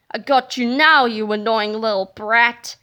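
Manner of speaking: low, loud monster villain; menacing low-pitched shout; Turbo Toilet two thousand. Acted. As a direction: threaten in a deep, forceful voice. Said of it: "shouted the Turbo Toilet two thousand".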